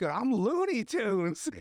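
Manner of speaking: high, slow, drawn-out boast; taunting kid. Acted. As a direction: play a crazy kid showing off. Acts a kid taunting the victim.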